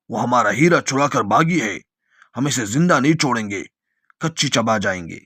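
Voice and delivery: plain reading voice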